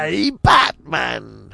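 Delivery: loud, drawn-out cartoon villain call; hammed-up villain greeting, slow and loud; Mark Hamill impression. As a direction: stretch it out in a theatrical villain voice. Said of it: "he's doing Mark Hamill impression".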